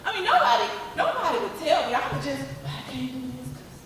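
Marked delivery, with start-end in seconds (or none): 2.6-3.8 quiet excuse. Retold or imitated. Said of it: "I'm giving all these excuses".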